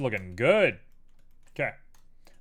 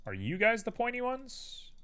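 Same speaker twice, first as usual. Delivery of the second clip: much higher, cutesy voice asking; talking to vertices; 10 semitones higher than usual. Real, not acted. Modeler asks the vertices playfully, much higher cutesy voice. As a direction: coo at the vertices like pets.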